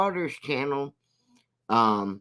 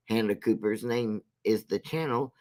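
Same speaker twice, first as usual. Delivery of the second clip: ordinary talk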